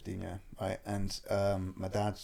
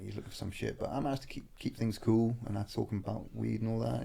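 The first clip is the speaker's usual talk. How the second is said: ordinary delivery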